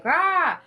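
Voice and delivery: roaring the anger out; angry roar. Retold or imitated. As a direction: let out a short angry roar. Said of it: "you're like".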